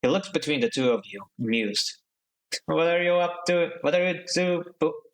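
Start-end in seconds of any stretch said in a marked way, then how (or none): 2.7-5.0 sleepy crew mate voice. Acted. Yawning Seraphim speaks.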